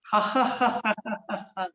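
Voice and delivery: high villain cackle; evil chuckle. Shown as a demonstration. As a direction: laugh like a movie villain.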